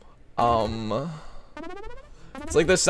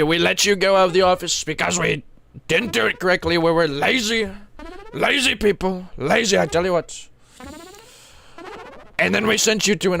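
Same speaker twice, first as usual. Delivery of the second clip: a lightly voiced doctor saying oopsie doopsie; Trump impression. Doctor telling a patient they nearly killed him, mildly voiced. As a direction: play the careless doctor, pleased with himself. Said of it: "if I was actually Trump".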